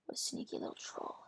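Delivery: barely audible whisper; whispered muttering. Real, not acted. Player mutters about the opponent's sneaky move, whispered. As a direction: whisper it to yourself.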